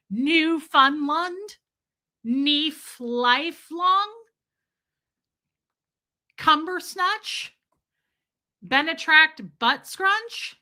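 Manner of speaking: high, slow, puzzled sounding-out; mock mangled names. Mocking how she reads the chat's words. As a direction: drag out each garbled guess. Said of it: "and I'm like".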